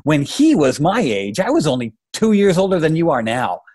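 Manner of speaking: high-pitched, agitated father's protest; worked-up dad, shaken by the numbers; heated. A narrator's reading. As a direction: argue with rising heat, pitch up. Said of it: "filled him with a strange, heart-shaking heat".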